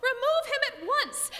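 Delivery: a manager bursting with rage; enraged. A narrator's reading. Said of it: "purple with rage".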